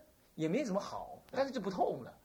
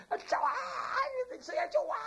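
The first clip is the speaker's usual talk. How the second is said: high mock agonized shriek; imitating screams for help. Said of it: "这样子叫叫叫". (模仿高声哭喊求救)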